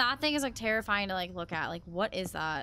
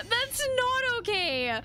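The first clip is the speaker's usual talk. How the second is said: high-pitched, whining protest; panicked whine; 10 semitones higher than usual. Real, not acted. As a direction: whine it in real fright. Panicked repeat after scare.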